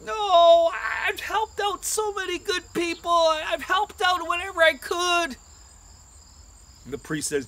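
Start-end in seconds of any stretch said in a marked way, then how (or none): 0.0-5.4 high-pitched pleading disbelief; acted anguished plea; distraught protest. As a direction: protest your fate in a high voice. David protests the diagnosis.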